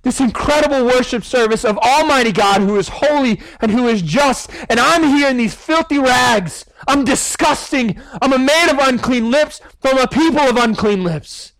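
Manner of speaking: high-pitched, pleading lament; Isaiah's anguished weeping. Acted. Prophet in filthy rags before God, lamenting in a high, anguished voice. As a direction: cry it out in disgust at yourself. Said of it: "I just see him weeping".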